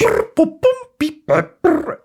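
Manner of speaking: high robotic brr boom beep; robot recalibrating noises. Pretend. Siri switches accents with high robot noises. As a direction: chirp like a machine rebooting.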